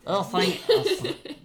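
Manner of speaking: laughing into a Russian streamer voice; mock Russian accent. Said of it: "Someone give me a Russian accent".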